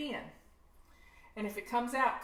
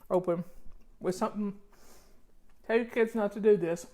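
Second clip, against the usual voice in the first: talking through a mouthful; mouth full; 3 semitones lower, 5 dB louder than usual. Real, not acted. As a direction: mouth full while speaking. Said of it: "I'm talking with my mouth o-, open with something".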